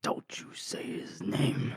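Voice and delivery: hushed, drawn-out whisper; playfully ominous whisper; mock warning. Mock warning about forbidden name.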